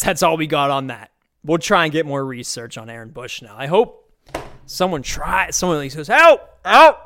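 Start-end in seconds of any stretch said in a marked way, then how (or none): 6.1-7.0 high, loud desperate cry for help; calling for help. Pretend. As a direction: yell it like someone screaming for help. Imagined bystander calling for help.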